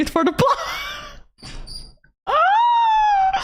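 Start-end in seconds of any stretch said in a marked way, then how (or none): 2.2-3.4 very high startled scream; high shriek. Real, not acted. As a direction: shriek in mock shock. Shocked scream at comment.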